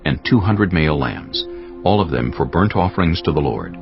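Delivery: ordinary talk, nothing marked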